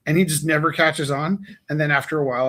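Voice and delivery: normal talking voice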